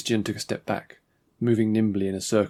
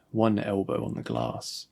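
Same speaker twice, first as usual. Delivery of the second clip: ordinary delivery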